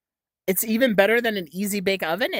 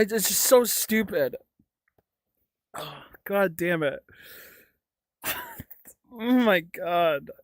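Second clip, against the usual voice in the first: ordinary delivery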